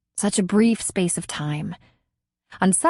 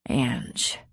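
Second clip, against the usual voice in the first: low, soft, tender name; softly; 7 semitones lower, 6 dB quieter than usual. A narrator's reading. Tenderly saying her name to Angie. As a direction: say her name gently, lower. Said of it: "he said softly".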